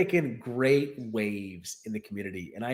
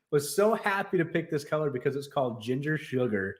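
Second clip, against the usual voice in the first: ordinary delivery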